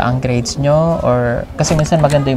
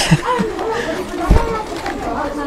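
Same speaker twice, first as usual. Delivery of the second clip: high, drawn-out laugh; real giggling fit cutting off the sentence; 5 dB quieter than usual. Real, not acted.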